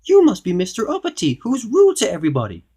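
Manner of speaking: high, loud little goblin. A narrator's reading. Goblin naming rude Mister Uppity, high little voice. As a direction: call him out in a goblin squeak. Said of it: "said the goblin".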